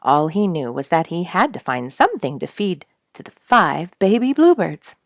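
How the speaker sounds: ordinary delivery, nothing marked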